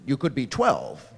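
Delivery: ordinary talk, nothing marked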